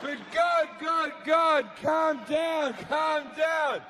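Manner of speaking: high, pleading shout over his rage; urgent calming down. Acted. People trying to calm God down, urgent pleading. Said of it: "people are trying to calm him down".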